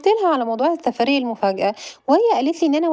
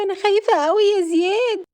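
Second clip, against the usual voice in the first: high, pleading fear; cling to her brother in fright; frightened; 9 semitones higher than usual. Acted. Sister tells her brother she's scared, high and pleading.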